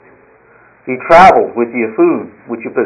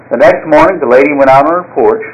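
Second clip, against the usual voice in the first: ordinary talk, nothing marked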